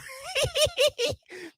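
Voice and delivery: very high cackling laugh; high shrieking laugh. Real, not acted. Bursts into laughter.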